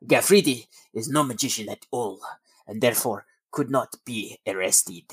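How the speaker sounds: playful put-on French accent; a hammed-up French accent. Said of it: "my attempt at French accent".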